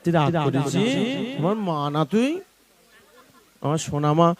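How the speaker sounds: tender coaxing. Real, not acted. Coaxing an upset old woman.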